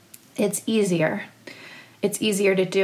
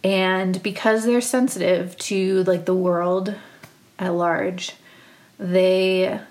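normal talking voice

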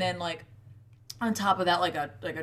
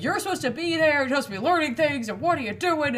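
mock scolding father; 7 semitones higher, 4 dB louder than usual. Odin scolding Thor.

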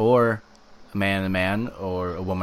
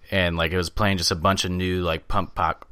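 ordinary talk

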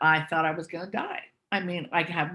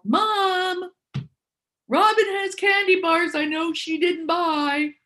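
tattling yell; 10 semitones higher, slower than usual. Retold or imitated. Brother in the tree house yelling to Mom, high gloating yell. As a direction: shout it up at Mom like a gleeful snitch. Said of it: "he yelled".

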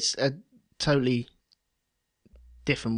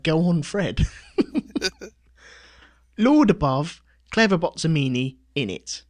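mock Cockney; 4 semitones higher, 5 dB louder than usual. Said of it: "Go, Fred".

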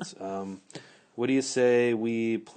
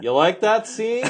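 high, loud call from another room; calling out from the next room; 12 semitones higher, 7 dB louder than usual. Retold or imitated. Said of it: "she's like, from the other room".

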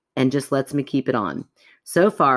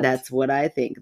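normal talking voice

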